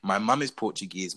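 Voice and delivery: usual speaking voice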